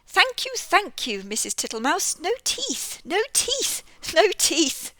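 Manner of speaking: high, loud squeaky toad. Acted. Mister Jackson the toad politely refusing cherry stones. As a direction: play the toothless old toad.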